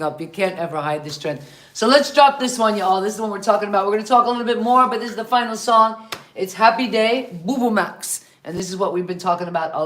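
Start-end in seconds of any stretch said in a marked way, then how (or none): 1.7-8.3 louder, higher, upbeat song intro; radio host announcing. Acted. Host introducing the final song to listeners, upbeat announcer voice. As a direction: hype the track like a DJ.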